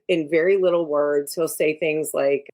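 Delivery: normal talking voice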